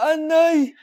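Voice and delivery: a short startled cry of protest; sudden loud protest; pained yelp. Acted. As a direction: yelp as the truth comes out. Child cries out while exposed.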